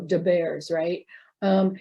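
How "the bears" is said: "da bears" with a hard d in "da"; Chicago-style "da" for "the" in "da bears"; Chicago pronunciation. Shown as a demonstration. Lecturer quoting Chicago talk, "da bears" with d for th. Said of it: "you hear probably, um, Chicago".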